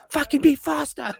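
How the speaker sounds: hammed-up exasperated order; impatient snapping. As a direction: hurry them along in a high, strained voice. Acted bartender hurrying dishwasher.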